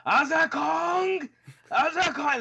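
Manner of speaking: drawn-out, high-pitched yell; high wailing battle yell; imitated yell. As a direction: mimic a wrestler yelling out her rival's name. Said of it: "she kept yelling".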